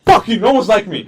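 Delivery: high, loud retort through laughter; laughing while retelling his teen anger; laughing mock anger. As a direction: yell it back while cracking up. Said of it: "I was like".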